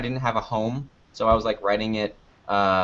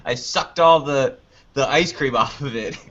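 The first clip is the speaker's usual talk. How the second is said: laughing through the words; 2 semitones higher than usual. Real, not acted. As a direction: giggle through the confession. Laughing while telling the story.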